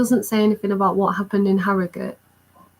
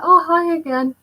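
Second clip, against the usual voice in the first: pitched way up, mimicking his cheery reply; high amused greeting; 9 semitones higher than usual. Quoting the band member's cheery hello, high. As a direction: bounce the greeting back high and bright. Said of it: "He was like".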